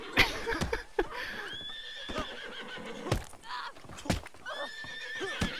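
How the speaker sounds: very high breathy laugh that keeps going; wheezing helpless laugh; long airy squeal of laughter. Real, not acted. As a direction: crack up at his own pun. Laughing at his own joke.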